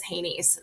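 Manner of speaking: "Haney's" said softly as HAY-neez; pronounced "Haney's". Shown as a demonstration. Guest clarifies the name for listeners, "Haney's" softly pronounced HAY-neez. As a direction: say the acronym "Haney's" as one word, HAY-neez. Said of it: "we just pronounce that as Haney's".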